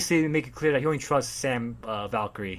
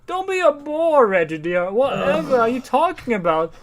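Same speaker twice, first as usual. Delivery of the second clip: high, drawn-out society-lady caricature; socialite wife; 11 semitones higher, 5 dB louder, slower than usual. Retold or imitated. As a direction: play a hammed-up rich wife, slowly. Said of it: "his wife's like".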